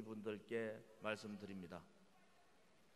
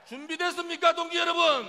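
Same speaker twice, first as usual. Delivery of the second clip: much louder, higher rousing call; rallying shout; 14 dB louder than usual. Acted. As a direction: fire up the crowd with a roar. Leader rouses crowd before chant. (군중을 향한 우렁찬 외침)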